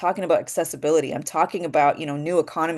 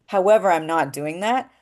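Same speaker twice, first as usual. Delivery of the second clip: normal talking voice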